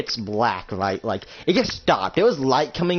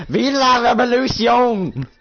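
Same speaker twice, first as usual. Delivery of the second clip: high, loud, drawn-out battle cry; Spanish revolutionary; 10 semitones higher, 5 dB louder than usual. Acted. Spanish man in a firefight, rallying cry. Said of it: "a Spanish man says".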